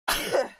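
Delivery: sick cough; real cough from a cold. Real, not acted. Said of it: "apologies for all the sneezing and the sniffling".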